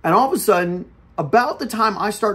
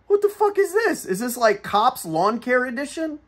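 high-pitched incredulous questioning; retold bewilderment; 8 semitones higher than usual. Driver watching trooper yell at old man on mower, incredulous. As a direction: act out baffled disbelief, pitch shooting up.

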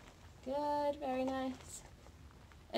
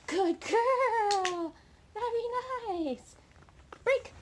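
gushing dog praise; 11 semitones higher, 5 dB louder, much slower than usual. Real, not acted. Trainer gushes over puppy's last bow. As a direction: stretch the praise out lovingly.

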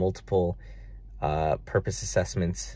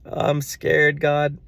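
high, loud, half-sobbing plea; a raised, teary cry of fear to God; retold tearful fear; 9 semitones higher, 7 dB louder, much slower than usual. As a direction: cry it out to God, higher and louder. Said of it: "just crying, crying".

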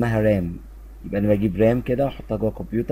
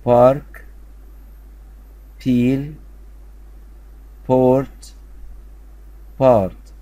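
each word called out much louder, with pauses to repeat; loud drill; 3 semitones higher, much slower than usual. Shown as a demonstration. Teacher calls out words for pupils to repeat, loud. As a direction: lead the choral drill, loud and clear.

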